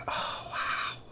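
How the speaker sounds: breathy whispered wow; awed whisper. Real, not acted. Vlogger whispers over the Vintage Butterflies pack. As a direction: whisper your amazement.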